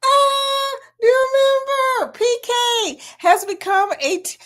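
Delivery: high delighted cry; a high happy announcement; excited squeal. Real, not acted. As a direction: squeal at the good news. New member announced.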